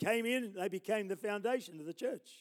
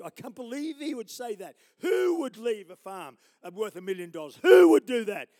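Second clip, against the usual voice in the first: outraged judge; 4 semitones higher, 13 dB louder than usual. Retold or imitated.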